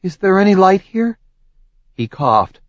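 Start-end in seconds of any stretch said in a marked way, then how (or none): 0.0-1.2 frightened. Acted. Frightened heroine in darkness.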